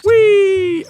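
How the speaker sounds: high, loud squeal of glee; gleeful whee. Real, not acted. Gamer squeals while jumping in the game. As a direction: let out a delighted whoop.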